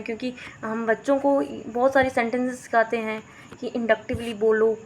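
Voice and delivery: ordinary talk, nothing marked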